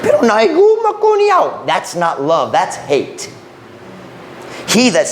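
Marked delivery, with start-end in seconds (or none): 0.0-1.7 much higher mocking voice of a critic; mock objector. Preacher mimicking a critic of spanking, high and mocking. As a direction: squeak out the objection like a scoffer.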